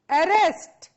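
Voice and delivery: firmly projected word for repeating; loud model word. Shown as a demonstration. Teacher leading students in loud repetition, called out. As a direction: call it out for a class to echo. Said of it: "મોટેથી બોલવાનું છે".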